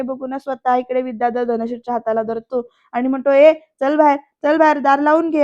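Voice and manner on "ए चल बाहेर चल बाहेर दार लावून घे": loud, impatient command; forceful raised voice; angry order. A narrator's reading. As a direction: bark the wife out of the room. Husband drags wife out.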